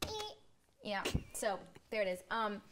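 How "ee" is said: high-pitched buzzer squeal; wrong answer buzzer. Pretend. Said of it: "Mine's ee".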